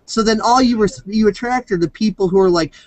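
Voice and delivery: usual speaking voice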